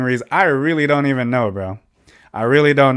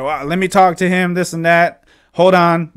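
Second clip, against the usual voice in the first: higher, louder, trying to hold him off; retold urgent placating; 6 semitones higher, 4 dB louder than usual. Dad asking him to wait, urgent retold placating. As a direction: imitate someone hurriedly calming a caller.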